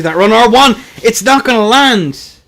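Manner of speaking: high, loud, rising call; excited play-by-play. Real, not acted. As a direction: shout the attack as it misses. Live call of an attack.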